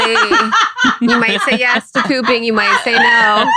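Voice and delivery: cracking up, mid-giggle; real giggling. Real, not acted. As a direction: giggle the words out. Giggling about pooping.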